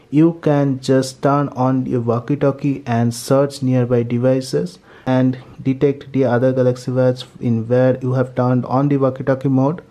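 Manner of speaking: normal talking voice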